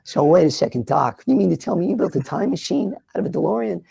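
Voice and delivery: put-on incredulous kid questioning the doc; a light Marty McFly voice. Shown as a demonstration. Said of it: "Marty McFly".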